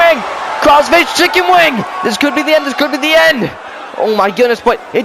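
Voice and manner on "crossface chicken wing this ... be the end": excited call. Real, not acted. Calling a submission hold.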